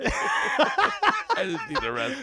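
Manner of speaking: mock the line while cracking up; laughing mimicry. Mimicking drugged Joey, laughing.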